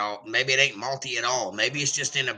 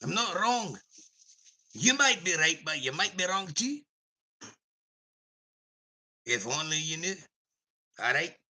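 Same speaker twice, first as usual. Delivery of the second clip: lightly voiced Scot, now calmer and smug; Scottish accent; 3 semitones higher, 4 dB quieter than usual. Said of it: "Back to myself".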